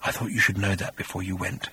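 low, soft creature whisper; cold, hushed parting words; demonic whisper. A narrator's reading. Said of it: "whispered the creature".

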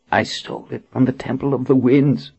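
rasping hoarse whisper-voice. A narrator's reading. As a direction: speak through a ruined, hoarse throat. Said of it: "Amelia said in a hoarse voice".

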